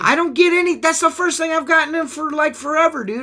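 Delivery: high-pitched indignant whine; whiny protest. Real, not acted. Defending himself against teasing.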